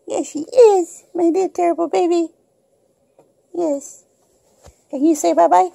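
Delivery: high, cooing voice with a laugh. Real, not acted. Owner cooing to her rescued kitten, high laughing baby talk. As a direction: coo at the little kitten.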